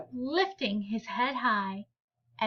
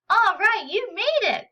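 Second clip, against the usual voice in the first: higher, louder happy call; calling out to a friend; 9 semitones higher, 5 dB louder than usual. Acted. Friend shooting baskets calls out to Ron, loud and glad. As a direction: cheer him on from across the yard.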